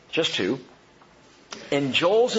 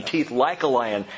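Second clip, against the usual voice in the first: normal talking voice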